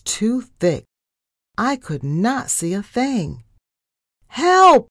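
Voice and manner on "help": very high, loud scream; screamed cry for help. A narrator's reading. Narrator about to crash into an iceberg, screaming. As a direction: scream out in alarm. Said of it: "I screamed again".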